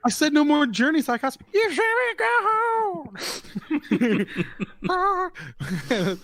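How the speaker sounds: shrill mock rock singer cry; high falsetto. [name] bit, high.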